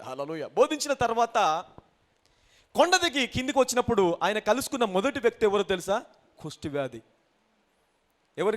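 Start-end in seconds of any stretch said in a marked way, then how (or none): none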